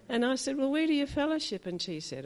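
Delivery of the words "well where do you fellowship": high, quick, eager asking; an older woman's excited question to two young churchgoers; delighted question. Retold or imitated. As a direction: ask brightly, thrilled to hear the news.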